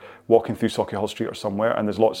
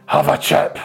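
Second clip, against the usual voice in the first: put-on much higher, louder Glasgow drunk in a Scottish accent; pushy friendly Scottish drunk, Scottish accent; aggressively friendly drunk; 6 semitones higher, 5 dB louder than usual. Glasgow drunk pressing a chip on a passer-by, Scottish accent. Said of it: "aggressively friendly".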